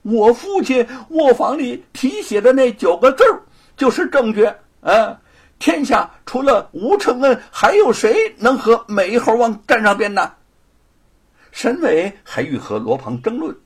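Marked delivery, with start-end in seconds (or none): 0.0-10.3 傲慢. Acted. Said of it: "罗庞斜着眼看了看他".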